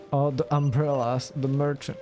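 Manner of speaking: ordinary delivery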